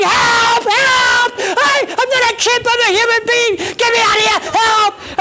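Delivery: high, loud panicked screams; screaming for help. Retold or imitated. Man in the lion's cage to onlookers, screaming in panic. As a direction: scream desperately to be let out, hammed up for comedy. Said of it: "And I'm screaming".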